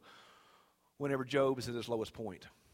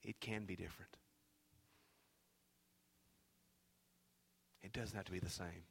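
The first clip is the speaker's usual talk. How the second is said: very soft, low reassurance; hushed preaching; 5 semitones lower, 14 dB quieter than usual. Real, not acted. Preacher telling listeners life can change, hushed and low. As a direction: let it land quietly, with long pauses.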